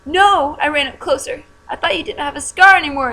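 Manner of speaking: high, loud alarmed cry; shouted. Acted. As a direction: shout in alarm running up to him. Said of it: "I shouted".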